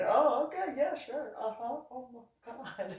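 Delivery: high, hammed-up disbelief; mock stunned reply. Vlogger imitating the biker's disbelief about the Sturgis campground. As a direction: play it as a biker who can't believe her.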